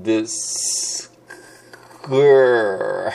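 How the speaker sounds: ディス ク stretched slowly, consonants held apart; slow drawn-out syllables. Shown as a demonstration. Teacher breaking description into sounds. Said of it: "三つありますね".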